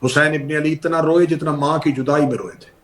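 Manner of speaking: ordinary talk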